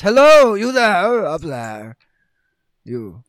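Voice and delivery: a chess king hailing the party from above; king's voice. Acted. The chess king calls out grandly to the players. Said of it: "the king says".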